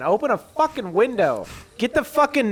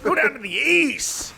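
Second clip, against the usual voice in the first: demanding dad; 9 semitones higher than usual. Retold or imitated. Voicing the dad demanding paint.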